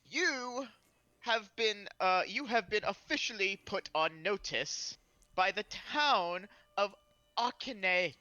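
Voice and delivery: booming formal herald addressing a crowd; commanding announcement. Acted. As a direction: announce it slowly and grandly, pausing for weight. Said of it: "announce in a commanding voice".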